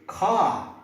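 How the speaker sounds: car in a British accent, no r sound; British car ending in an open ah. Shown as a demonstration. Teacher showing British car with a silent r. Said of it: "if you're following British English".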